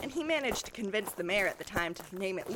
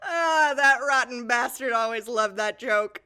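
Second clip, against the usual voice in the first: loud, crying laugh about the father; tearful fondness; 10 dB louder, slower than usual. Acted. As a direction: choke up over the old joke. Sentimental about dead father.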